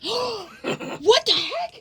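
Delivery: gasp, then high drawn-out words; shocked disbelief. Real, not acted. Friend seeing a thumb bend oddly, gasping disbelief.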